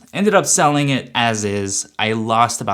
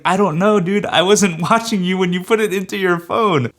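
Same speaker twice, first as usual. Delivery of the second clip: higher, laughing in disbelief; laughing exasperation at a clueless buyer; exasperated laugh; 8 semitones higher, 4 dB louder than usual. Retold or imitated.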